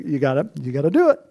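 ordinary talk, nothing marked

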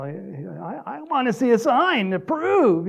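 lightly hammed-up demanding voice; mock sign-seeker. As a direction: imitate someone insisting on proof. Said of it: "the people who are looking for signs".

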